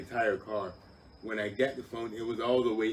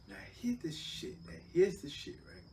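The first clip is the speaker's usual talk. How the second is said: whisper; 10 semitones higher, 3 dB quieter than usual. Real, not acted. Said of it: "setting up twist".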